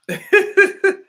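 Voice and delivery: loud high laugh; cackling at the joke; high burst of laughter. Real, not acted. Laughs at own quote.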